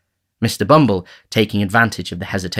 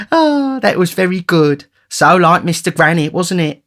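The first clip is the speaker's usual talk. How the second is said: delighted matron; 9 semitones higher, 5 dB louder than usual. Acted. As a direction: coo with amused delight. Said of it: "interposed the matron".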